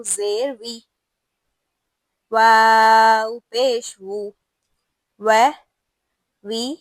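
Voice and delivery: each harakat chanted slowly and loudly, with pauses between; slow, loud Qur'anic spelling drill; slow recitation drill. Shown as a demonstration. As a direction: spell out each vowel mark like a teacher drilling a class. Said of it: "اب ہم اس حرف کو حرکات لگاتے ہیں".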